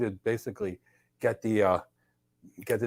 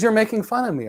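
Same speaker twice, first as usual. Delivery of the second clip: higher-pitched, mildly offended accusation; indignant accusation; 9 semitones higher, 3 dB louder than usual. Retold or imitated. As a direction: accuse them, as if retelling a quarrel. Partner accuses him in retold quarrel.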